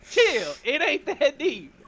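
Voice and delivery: high, drawn-out "chill" brush-off; slow it down and pitch it up, fed up; exasperated dismissal. Acted. Character tells accuser to calm down.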